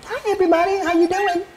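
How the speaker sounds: very high pitched fairy filter; chipmunk-like high voice effect; fairy voice changer. Shown as a demonstration. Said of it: "some sort of fairyland".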